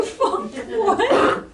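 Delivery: high, laughing bewildered blurt. Retold or imitated. Comic laughs while retelling forgetting words on stage, high laughing blurt. Forgetting English at open mics.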